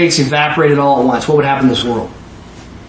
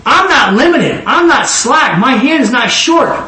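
laughing declaration; 7 semitones higher, slower than usual. Retold or imitated. Quoting God, laughing.

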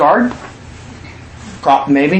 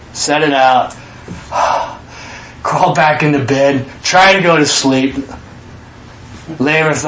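ordinary delivery

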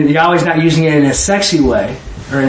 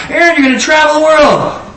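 prophetic shout; 8 semitones higher than usual. Retold or imitated. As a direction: proclaim it like a prophet over Aaron. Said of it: "the guy's talking about".

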